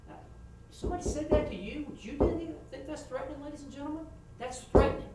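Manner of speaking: high, pressing appeal to the jury; closing argument. Shown as a demonstration. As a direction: lean on the jurors to feel the threat. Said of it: "demo closing to the jury".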